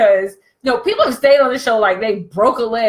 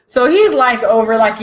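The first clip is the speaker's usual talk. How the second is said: ordinary talk, nothing marked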